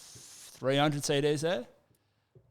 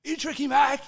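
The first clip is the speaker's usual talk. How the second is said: calling out; 12 semitones higher than usual. Retold or imitated. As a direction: shout it across to a stranger. Stranger calls across car park.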